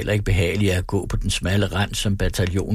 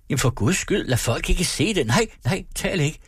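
anxious hushing; 8 semitones higher than usual. A narrator's reading. Husband hushing his crying wife.

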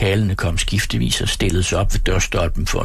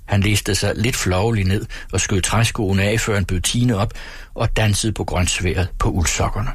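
usual speaking voice

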